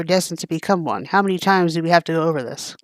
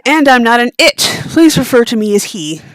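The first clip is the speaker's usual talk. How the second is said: a louder touch of giant creature; lightly voiced behemoth; 4 semitones higher, 6 dB louder than usual. Behemoth correcting them, lightly voiced creature. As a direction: insist with a hint of monster weight.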